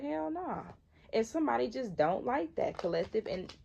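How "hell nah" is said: high, scornful hell nah; flat refusal. Retold or imitated. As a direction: dismiss it with sass, pitched up. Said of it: "your self-respect and your integrity is like".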